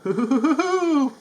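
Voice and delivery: high whooping Tigger laugh; laughing hoo-hoo. Acted.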